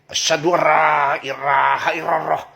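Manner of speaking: "Asyhadu alla ilaha illallah" in a loud mock Japanese accent, l turned to r; loud hammed-up Japanese accent on the shahada; 日本語なまりで大げさに唱える. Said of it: "coba kamu orang Jepang".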